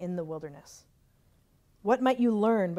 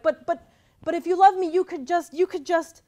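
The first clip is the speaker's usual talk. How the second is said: high, loud, sputtering protest; 9 semitones higher, 7 dB louder than usual. Retold or imitated. Arguing back at God, a pleading sputtering protest. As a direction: stammer out a desperate objection. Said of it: "I was like".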